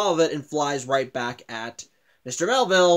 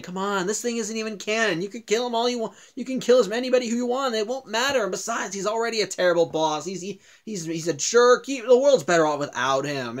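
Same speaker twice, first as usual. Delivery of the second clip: higher-pitched coaxing, pushing her on; insistent, lightly acted goading; urging; 6 semitones higher than usual. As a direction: egg her on in a raised voice. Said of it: "keeps urging her on, saying".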